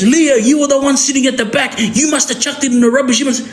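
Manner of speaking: high, frantic accusation; accusing rant. Retold or imitated. Tied-up uncle blaming Leo, shrill frantic accusation. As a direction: blame him in a high panicked rant. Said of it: "He goes".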